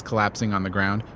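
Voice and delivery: plain reading voice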